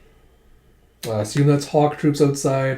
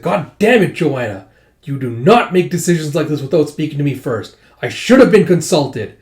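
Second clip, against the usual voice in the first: furious outburst; 2 semitones higher, 6 dB louder than usual. Acted. Father scolds Joanna for deciding without him, furious shout. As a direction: blow up at your daughter for going behind your back.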